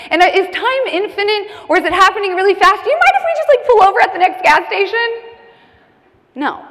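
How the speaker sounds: high, loud, spiralling questions; panicked rambling. Acted. Passenger mid panic attack begging to pull over, panicked and high. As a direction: blurt it out in a mounting panic. Acting out her panic in the car.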